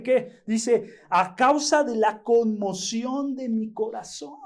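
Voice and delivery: high, loud, fervent preaching; preaching at full force. Acted. Pastor telling brothers David was sick, full-force preaching.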